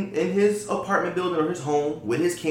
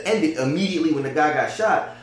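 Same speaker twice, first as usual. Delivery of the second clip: ordinary talk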